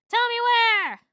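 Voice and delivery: high, loud threatening shout; loud, high interrogation shout; furious demand. Acted. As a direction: yell it right in his face. Stinger at Hector's nose.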